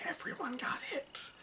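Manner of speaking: hushed whisper for emphasis; dramatic whisper. Real, not acted. Game master reveals the rage hit everyone, dramatic whisper. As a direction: repeat it in a stunned whisper.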